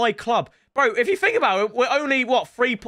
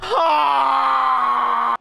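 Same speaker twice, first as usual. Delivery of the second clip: loud high cry of despair; real anguished ah; anguished yell; 5 semitones higher, 4 dB louder than usual. Real, not acted. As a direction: let out a pained shout as it misses. Shot misses.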